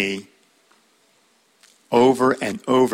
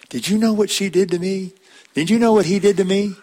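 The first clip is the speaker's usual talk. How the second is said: high, mock-indignant gossip voice; gossiping complainer; 8 semitones higher than usual. A preacher mimicking a gossip telling others. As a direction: play the hurt gossip spreading the story. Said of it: "I'm not gonna bring it up to others".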